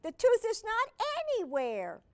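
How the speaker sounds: alarmed. Acted. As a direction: sound worried and lost. Pete searching, alarmed.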